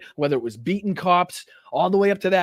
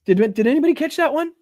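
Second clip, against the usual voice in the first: mocking disbelief; 6 semitones higher, 6 dB louder than usual. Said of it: "There was a permitted protest".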